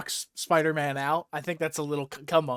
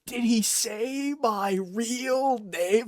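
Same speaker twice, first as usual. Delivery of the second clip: slow, higher petty worry; mock worried Daredevil; 6 semitones higher, much slower than usual. Daredevil fretting over his name, slow higher worried whine. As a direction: fret only about your secret. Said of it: "Fucking Daredevil goes".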